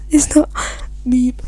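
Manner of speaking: stifled laughter. Real, not acted. Reader breaks up reading Lucius.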